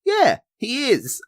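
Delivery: high, slow drawn-out agreement; slow high mock of her reply; hammed-up imitation of Justine; Justine's deadpan delivery. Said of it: "laughed so hard at Justine's delivery".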